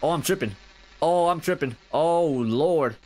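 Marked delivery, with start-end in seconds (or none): none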